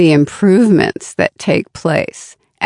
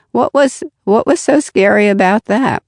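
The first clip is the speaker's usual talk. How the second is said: higher, puzzled self-questioning; relieved afterthought; 4 semitones higher, faster than usual. Acted. Learner looking back on a challenge, lightly incredulous. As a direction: wonder at the old fear, pitch lifted. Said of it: "learn over time and think".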